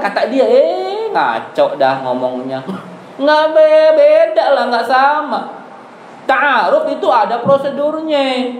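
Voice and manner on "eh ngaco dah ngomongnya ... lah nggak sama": exasperated laughing denial; indignant protest. Real, not acted. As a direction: scoff then protest, laughing. Reacting to ustadz's claim.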